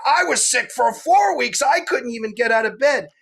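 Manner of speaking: high, one-upping mock voice; second woman topping the story, high mock voice; mocking the other woman. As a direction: outdo her, high and whiny. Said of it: "Then the other one's like".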